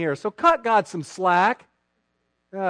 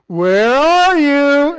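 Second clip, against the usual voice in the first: put-on high, loud, drawn-out sing-song call to a hiding child; playful seeking call; 8 semitones higher, 10 dB louder, much slower than usual. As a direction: call out slowly like a parent playing hide and seek. Parent pretending to seek child.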